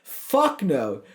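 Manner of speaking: ordinary talk, nothing marked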